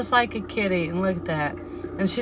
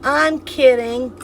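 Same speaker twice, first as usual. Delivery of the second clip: slow emphatic reading; 5 dB louder, slower than usual. Acted. Reading a chat comment aloud.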